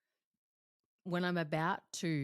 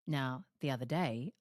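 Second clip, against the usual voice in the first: normal talking voice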